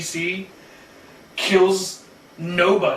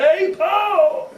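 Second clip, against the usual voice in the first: high calling voice; 13 semitones higher, 3 dB louder than usual. Acted. Calling out in a put-on voice.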